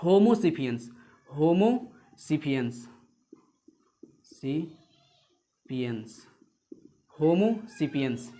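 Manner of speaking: spelled out syllable by syllable, slow; slow dictation. Shown as a demonstration. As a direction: say "homo sapiens" slowly for notes. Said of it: "बता देते हैं". (धीरे-धीरे "होमो sapiens")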